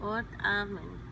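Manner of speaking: soft chanted prayer over music; quiet devotional chant; prayer recitation. Acted.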